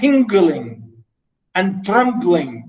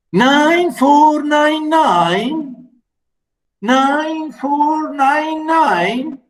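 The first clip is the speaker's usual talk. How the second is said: high, very slow stunned question; wife repeats the number, unable to believe it; drawn-out high disbelieving question; shocked disbelief; 9 semitones higher, 3 dB louder, much slower than usual. Acted. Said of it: "she asked, turning pale".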